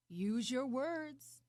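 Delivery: insistent demand. Acted. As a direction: demand it firmly and slowly. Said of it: "the man demanded".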